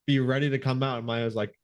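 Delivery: normal talking voice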